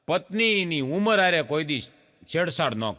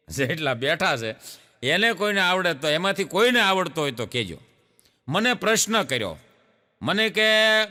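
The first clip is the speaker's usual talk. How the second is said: ordinary talk, nothing marked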